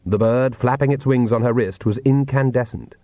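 ordinary delivery

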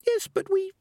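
very high squeaky protest; tiny high creature voice; Peaches. A narrator's reading. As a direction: squeak a small objection, cut off. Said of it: "said the voice of Peaches".

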